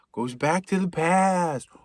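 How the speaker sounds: slow, mock-dramatic repeat; a hammed-up spooky goes back to the past; dramatic echo. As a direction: intone it like a movie trailer.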